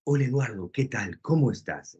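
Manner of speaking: imitating a friend's velvety radio voice; romantic radio host. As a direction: lull him like a smooth radio announcer. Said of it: "siempre me habla así".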